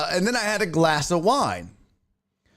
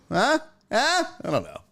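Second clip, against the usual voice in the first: ordinary delivery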